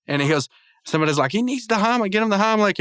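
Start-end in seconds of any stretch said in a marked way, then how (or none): 1.3-2.8 high-pitched mock panic; retold alarm. Bystander demands the Heimlich, imitated alarm. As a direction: urge help like a worried cartoon bystander. Someone urges help for choking man.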